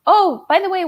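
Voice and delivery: high, sly mom; mom's voice. Retold or imitated. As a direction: switch to mom, high and pointed. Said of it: "then she's like".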